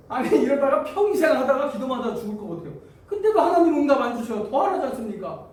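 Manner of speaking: high, loud fed-up protest; exasperated complaint. Acted. Believer weary of unanswered prayer, exasperated complaint. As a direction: grumble loudly as if this will never end.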